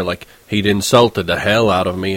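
ordinary delivery, nothing marked

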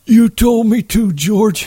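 high, miserable plea; Lenny miserably excusing himself to George. Acted. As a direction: say it miserably, childlike. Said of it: "he said miserably".

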